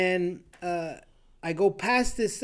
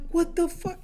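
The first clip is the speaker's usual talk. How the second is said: shocked; 11 semitones higher than usual. Retold or imitated. Shock at being splashed.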